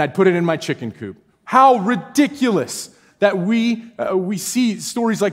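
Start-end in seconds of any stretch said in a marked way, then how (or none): 1.4-3.8 slow, higher and louder outcry; sermon outburst, slowed and raised; exclaiming. Real, not acted. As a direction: cry out how absurd it is. Preacher exclaiming.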